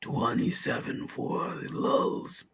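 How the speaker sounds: put-on very slow, soft whisper of a tough old gunslinger; showing how an old Clint Eastwood would sound. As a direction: breathe "Twenty-seven Fort Lulz" out slowly with no voice. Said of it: "that was like a twenty-five-year-old Clint Eastwood".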